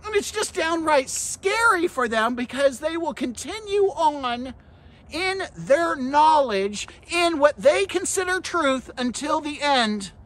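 high-pitched, emphatic sermon delivery; full-force preaching. Acted. Preacher warning about those in a false belief system, full-force preaching. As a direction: press the warning on the congregation.

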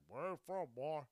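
throaty put-on questioner; phlegmy mock challenge. Comedian showing how the question stings, phlegmy mock challenge. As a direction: imitate a loaded, suspicious question. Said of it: "When people say it like that".

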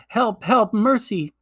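high, pleading cry for mercy; faint cry for help. A narrator's reading. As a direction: beg weakly for mercy. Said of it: "cried the witch in a faint voice".

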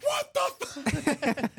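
high, slow shocked cry; shocked yell. Pretend. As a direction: squeal it in horror. Repeating the shocked line.

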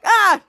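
short, loud, high mock yelp; acted fright, one quick burst; startled yelp. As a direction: cry out as if scared by a doorbell. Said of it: "He got scared by it".

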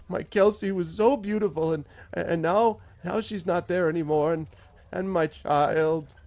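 plead, then crumble over his wife; desperate farmer near sobbing; anguished farmer. Acted.

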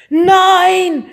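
loud high scream. A narrator's reading. Mika panics at the biscuit offer, screaming. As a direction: scream it and flee. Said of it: "schrie Mika".

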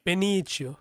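Benicio said ben-EE-chyo; habitual mispronunciation. Shown as a demonstration. Showing friends the slip, Benicio mispronounced as ben-EE-chyo. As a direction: turn the c of Benicio into "ch". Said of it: "I always say Benicio".

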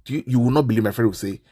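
normal talking voice

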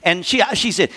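usual speaking voice

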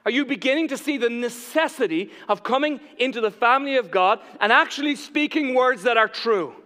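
high, loud, driving sermon delivery; impassioned preaching. Real, not acted. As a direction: preach it out to the whole room with force. Preaches at full force.

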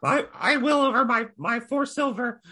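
high, hesitant goblin voice; high goblin voice addressing the barmaid; timid goblin. Acted. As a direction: creep up and ask nervously, pitched high. Goblin stands firm.

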